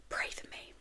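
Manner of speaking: soft, unvoiced whisper; whispered aside. Real, not acted. Podcaster to listeners before wrapping up, whispered aside. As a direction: drop to a whisper for a small plea. Wry aside about her period.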